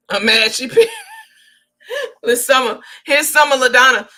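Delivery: real laughter breaking in; laughing. Real, not acted. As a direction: crack up as you say it. Laughing at commenter's name.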